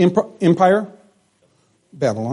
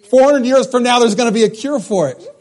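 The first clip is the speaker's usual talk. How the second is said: laughing punchline; 6 semitones higher than usual. Real, not acted.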